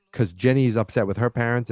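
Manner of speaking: ordinary delivery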